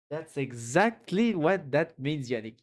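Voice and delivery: slowed right down, each word weighed; deliberate, drawn-out agreement; slow emphatic repeat. Real, not acted. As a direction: drag it out to drive the point home.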